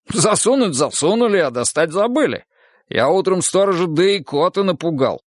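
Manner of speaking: mock complaint about old pals; amused Goga. A narrator's reading. Goga recalls being left under stage, amused.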